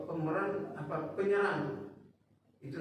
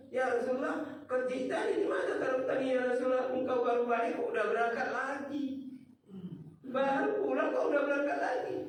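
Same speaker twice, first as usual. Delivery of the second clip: wife questioning; 7 semitones higher than usual. Acted.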